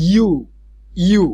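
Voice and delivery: "ইউ" said alone as "yoo", twice with a pause between; isolated opening sound. Shown as a demonstration. Teacher showing university starts without a vowel sound, sounded out. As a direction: sound out "ইউ" with its y-glide, separately. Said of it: "vowel sound সেটার কোনো sound হচ্ছে না".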